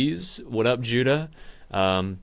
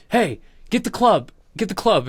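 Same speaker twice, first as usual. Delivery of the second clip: bossy order; 9 semitones higher than usual. Retold or imitated. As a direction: order them to grab the club. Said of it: "Duncan is like".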